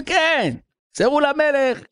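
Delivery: high, emphatic retold reply; mock calling out. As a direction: call the message out in a high pitch. Prisoner calls out his message. (קריאה מוגזמת בטון גבוה)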